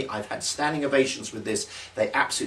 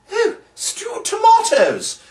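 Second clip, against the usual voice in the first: slow, much higher, louder lament; mock wail; 6 dB louder, much slower than usual. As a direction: overplay the dismay. Mock dismay, another can.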